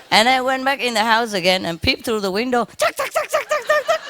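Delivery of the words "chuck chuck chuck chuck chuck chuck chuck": high chuck chuck pecking clicks; high rapid clicking pecks; bird pecking sounds. Retold or imitated. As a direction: mimic a bird eating fast. Imitating bird eating.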